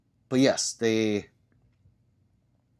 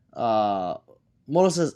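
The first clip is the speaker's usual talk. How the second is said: ordinary talk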